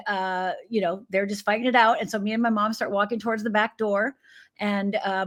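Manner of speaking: usual speaking voice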